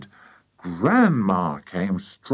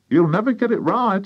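high, nagging wife's voice; scornful farmer's wife; 11 semitones higher, 4 dB louder than usual. A narrator's reading. Wife doubting the medicine, high scornful nag. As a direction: scoff in a high voice.

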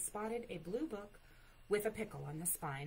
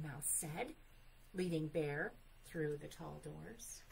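ordinary delivery

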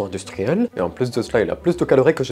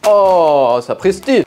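loud, higher shocked cry; shocked outburst; 8 semitones higher, 8 dB louder, much slower than usual. Acted. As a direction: blurt it out, startled. Chip explodes on his chair.

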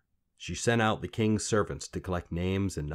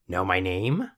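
sly little man; 3 dB louder than usual. Acted. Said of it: "the little man came again".

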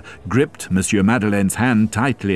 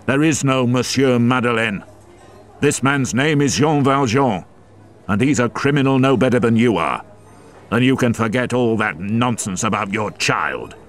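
angry; 6 semitones higher than usual. Acted. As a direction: denounce him with scorn. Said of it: "Javert interrupted her angrily".

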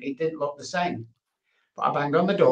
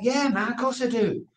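eager yes; 11 semitones higher than usual. Retold or imitated. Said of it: "I'm like".

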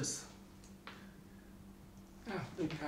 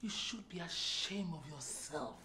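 low, very soft scolding; quiet reproach; 6 semitones lower, 18 dB quieter than usual. Acted. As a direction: shame him in a hushed, low voice. Wife scolds husband, first take.

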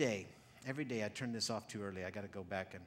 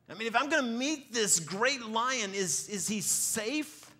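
slow, drawn-out worried question, higher; anxious question; 8 semitones higher, 8 dB louder, slower than usual. Acted. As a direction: ask it slowly, truly unsure if he's safe. Preacher voices worried Susan.

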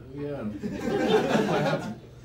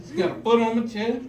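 ordinary talk, nothing marked

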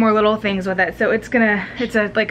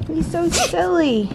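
higher and slower, cooing; 7 semitones higher, slower than usual. Real, not acted. Mom cooing higher and slower at her silly baby. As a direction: coo it up high and slow.

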